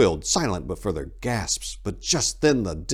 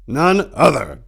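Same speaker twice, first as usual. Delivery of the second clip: grumpy; 8 semitones higher, 8 dB louder, slower than usual. A narrator's reading. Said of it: "Tor grumpily said".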